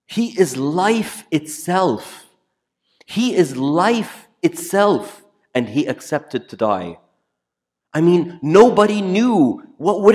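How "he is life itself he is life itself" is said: slow and higher, each word weighed; solemn proclamation. Acted. Preacher slowly proclaiming Christ is life.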